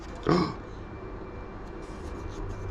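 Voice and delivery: high, breathy startled gasp; sharp gasp. Real, not acted. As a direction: gasp in alarm. Print about to break.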